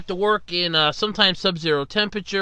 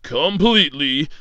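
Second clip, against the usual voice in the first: announcer voice; 2 semitones lower, 3 dB louder than usual. Acted. Mock show intro announcer.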